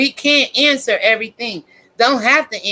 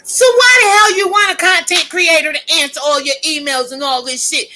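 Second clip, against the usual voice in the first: exasperated shout; 6 semitones higher, 4 dB louder than usual. Real, not acted. Ranting at demanding viewers.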